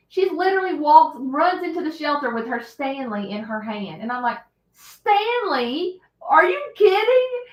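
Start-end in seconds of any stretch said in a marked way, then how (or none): 4.7-7.4 incredulous. Retold or imitated. Mocking daughter's cup.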